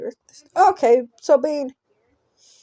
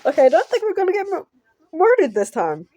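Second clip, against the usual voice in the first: rushed relieved aside; 6 semitones higher, faster than usual. Real, not acted.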